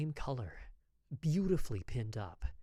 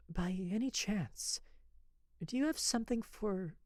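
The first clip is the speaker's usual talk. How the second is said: nervous; 8 semitones higher than usual. Acted. Lady timidly asking the apothecary for a remedy, nervous. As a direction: ask shyly, trailing off. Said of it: "I saw her nervous".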